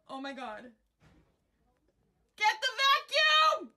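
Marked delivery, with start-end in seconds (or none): 2.4-3.7 high, slow, real scream for help; panicked shout. Real, not acted. Centipede in studio, panic.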